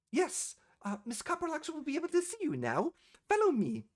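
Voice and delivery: high, polite clerk voice; receptionist voice. Acted. Receptionist ushering the party to the boss, high and polite.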